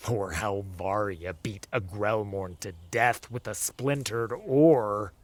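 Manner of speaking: ordinary delivery, nothing marked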